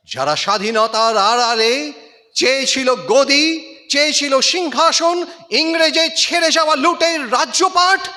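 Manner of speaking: rising loud, scornful recitation; indignant declamation. Acted. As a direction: build scorn toward the thrones they craved. Poem denounces power seekers.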